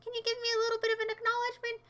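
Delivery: hammed-up needy plea; needy whiny voice. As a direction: beg for attention mockingly. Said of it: "you can just say".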